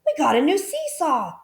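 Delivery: put-on high, thrilled child voice; excited children. A narrator's reading. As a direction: share the good news gleefully. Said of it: "they cried".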